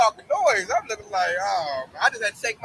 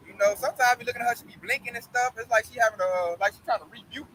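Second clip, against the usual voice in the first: ordinary talk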